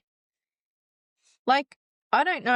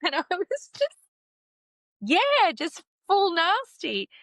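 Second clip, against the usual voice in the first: laughing; 10 semitones higher than usual. Real, not acted. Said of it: "kicking him in the".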